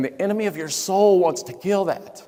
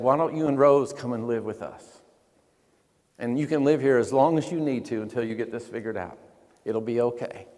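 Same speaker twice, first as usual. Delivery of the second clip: 1 gentle reassurance; 7 semitones lower, 6 dB quieter than usual. Retold or imitated. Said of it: "so graciously and kindly and without judgment".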